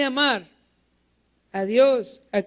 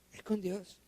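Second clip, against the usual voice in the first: near-whispered echo; 5 semitones lower, 17 dB quieter than usual. Real, not acted. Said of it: "repeats the answer".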